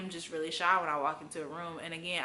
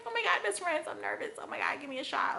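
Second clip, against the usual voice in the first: mock panicked gushing; 10 semitones higher, faster than usual. Panicked gushing to best friends.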